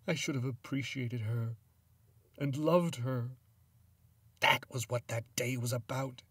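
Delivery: high-pitched, distraught lament; anguished regret. A narrator's reading. Widower regretting he did not love her, distraught.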